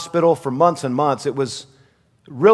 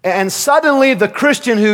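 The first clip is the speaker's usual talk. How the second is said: ordinary talk, nothing marked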